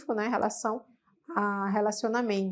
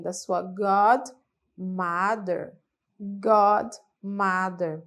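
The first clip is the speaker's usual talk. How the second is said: slow model word; 2 semitones lower, much slower than usual. Shown as a demonstration. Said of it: "você pede a bênção de quem?".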